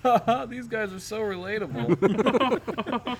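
high, drawn-out fake laugh and gushing; mock amused listener. Co-host imagines a listener on the drive home, mock amused. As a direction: put on a delighted fan, very slowly. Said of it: "they're gonna be like".

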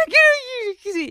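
high, drawn-out mock wail; retold crying scream. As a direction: whine it out in a hammed-up cry. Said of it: "me puse a llorar". (chillido llorón exagerado)

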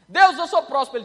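loud, ringing cry of faith; bold declaration. Pretend. Believer proclaiming healing to God, loud and bold. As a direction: shout the claim up to God.

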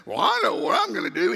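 loud imitation of a truck-driving good old boy; Bubba. Truck owner decides to join the chase, loud imitation. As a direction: belt it out as the big-truck guy. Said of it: "Bubba gets out of his big old truck and says".

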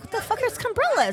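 high, baffled quoting self; retelling her own shocked reaction, incredulous; incredulous question. Retold or imitated. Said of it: "I'm like".

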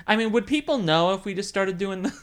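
normal talking voice

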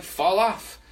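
ordinary talk

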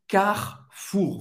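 Carrefour slow, with a throaty R; guttural French R. Shown as a demonstration. Teacher drills the French R, slowly. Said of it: "esse R bem francês, bem gutural".

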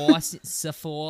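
laughing try at a Boston accent; attempted Boston accent. Shown as a demonstration. Host trying Boston accent as guest urges. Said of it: "Just say it".

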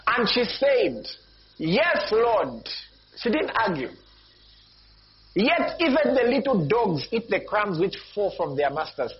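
high, ringing scripture declamation; emphatic preaching. Real, not acted.

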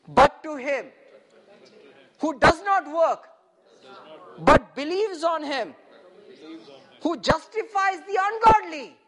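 loud, deliberate verse reading with gaps; very slow reading. Shown as a demonstration. Preacher leads congregation reading the verse, loud and slow. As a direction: declaim it phrase by phrase. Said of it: "I want you to read this very slowly".

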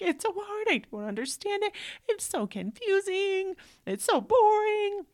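put-on high, teary child's whine; whining kid. Mother voicing her son's teary complaints about math, whiny. Mom voicing son crying.

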